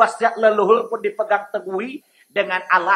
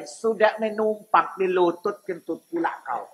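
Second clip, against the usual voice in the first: lower, softer Medan accent, teasing; Medan dialect; 4 semitones lower, 6 dB quieter than usual. Shown as a demonstration. Preacher mocks a freeloader in Medan accent. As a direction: drop into a Medan accent and scold. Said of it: "Ini kalau pakai dialek Medan".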